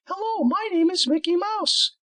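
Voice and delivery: very high squeaky cartoon mouse voice; Mickey Mouse voice. Student finally does the high cartoon mouse voice. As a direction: pitch way up like the Disney mouse. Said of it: "He talks in a much higher voice".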